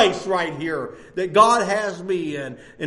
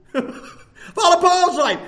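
slow and higher, through a laugh; 9 semitones higher, much slower than usual. Shown as a demonstration. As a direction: stretch it out while chuckling. Repeats phrase, second take laughing.

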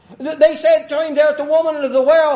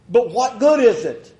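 ordinary talk, nothing marked

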